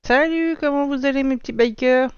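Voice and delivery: excited greeting. Real, not acted. Streamer greeting his viewers loudly.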